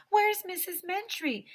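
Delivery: high, insistent storybook cry; alarmed question. A narrator's reading. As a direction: echo the alarm up high and loud. Second character echoes the alarm.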